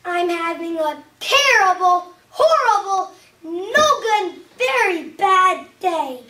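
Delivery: loud, emphatic lament, drawn out at the end; exasperated outburst. Acted. Story refrain at its climax.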